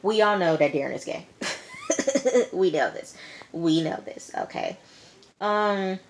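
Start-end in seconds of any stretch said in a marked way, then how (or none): 1.3-2.5 real burst of laughter. Real, not acted. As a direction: laugh out at the obvious. Laughs at obvious secret.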